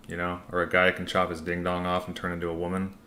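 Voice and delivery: ordinary delivery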